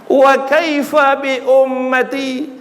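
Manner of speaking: high, loud, slow cry; anguished plea. Acted. Preacher voicing the Prophet asking for his people, high and slow. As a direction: plead for the people.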